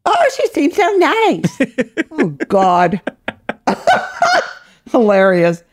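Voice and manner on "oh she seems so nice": high, sing-song mimicry; mock-sweet falsetto imitation; mocking echo. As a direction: parrot it back in a sugary high voice. Mimicking host's remark mockingly.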